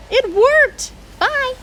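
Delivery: high, loud and delighted; a gleeful high farewell; thrilled cheer. Acted. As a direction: cheer as the birds fly off. Elephant thrilled the birds leave.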